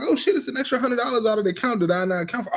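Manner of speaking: high, fast alarmed discovery; mock shock. Husband acting out finding a surprise charge, high mock alarm. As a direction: rush it out in high fake panic. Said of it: "I'm like".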